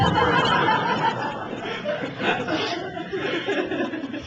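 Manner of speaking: a long, high, delighted laugh; high burst of laughter. Real, not acted. At the punchline of his Italian story, a high laugh. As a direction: break into real laughter.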